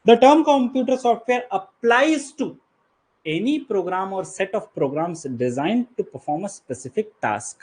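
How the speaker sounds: reads the definition out slowly and deliberately; slow formal reading. Acted. Teacher to students, reading the definition out slowly. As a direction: dictate each word like a textbook line. Reads legal text from notes.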